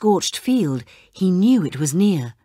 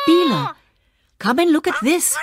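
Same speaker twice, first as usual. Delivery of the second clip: high, loud call to a friend; 9 semitones higher, 3 dB louder than usual. Acted. Zach calls Bela over, a high loud call. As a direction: call across the yard, excited. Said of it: "called Zach".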